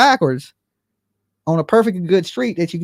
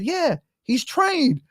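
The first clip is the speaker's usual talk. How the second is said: slow mock neighbor; 10 semitones higher, 3 dB quieter, much slower than usual. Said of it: "he said".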